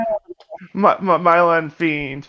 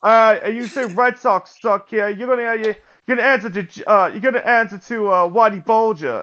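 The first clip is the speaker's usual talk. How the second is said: Boston tough talk, R's dropped; Boston accent; 4 semitones higher than usual. Acted. Bostonian warning a rival off, in a Boston accent. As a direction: threaten in a put-on Boston accent. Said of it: "you're not gonna do the accent?".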